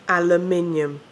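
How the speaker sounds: "Aluminium" the British way, al-uh-MIN-ee-um; loud, insistent "Aluminium" with MIN stressed. Shown as a demonstration. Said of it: "we say aluminium".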